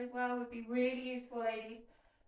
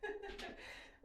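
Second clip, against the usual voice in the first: soft, high breathy laugh; amused giggle; 10 semitones higher, 8 dB quieter than usual. Real, not acted. Instructor laughing at forgetting the next exercise, soft giggle. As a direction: laugh quietly at your own slip.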